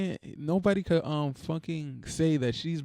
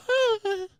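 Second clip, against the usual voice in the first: high-pitched mock giggle from swooning women; imitated giggling women; 4 dB louder than usual. Imitating women swooning over a footballer, high mock giggle. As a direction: squeal a giggle like adoring fans. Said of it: "and the women will go".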